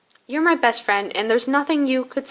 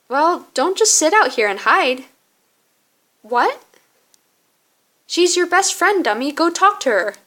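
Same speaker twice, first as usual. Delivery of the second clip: pitched up, insistent scolding of a friend; exasperated urging; 5 semitones higher, 4 dB louder than usual. Acted. Zach telling friend to go talk to her, exasperated. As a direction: push them along, exasperated.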